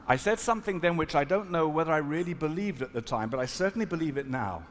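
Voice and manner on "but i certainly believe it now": ordinary talk, nothing marked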